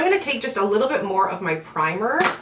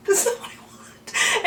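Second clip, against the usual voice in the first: high breathy sarcastic whisper through a laugh; 13 semitones higher, slower than usual. Retold or imitated. Mocking the PR package she keeps getting, sarcastic whisper. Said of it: "And I was like".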